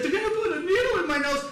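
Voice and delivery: high, loud mock wail; hammed-up bawling voice. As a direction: wail it like a panicking boy. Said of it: "Now he starts bawling".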